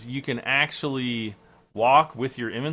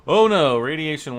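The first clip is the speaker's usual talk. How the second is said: alarmed; 4 semitones higher, 10 dB louder than usual. Real, not acted. Radiation warning pops up.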